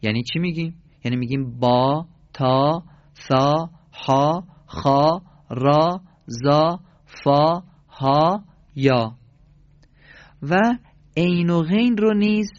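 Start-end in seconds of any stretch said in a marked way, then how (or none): 1.6-9.2 با، تا، سا with a long open ā; Arabic letter names. Shown as a demonstration. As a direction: stretch each name as bā, tā, sā, deliberately. (تلفظ عربی با آ کشیده)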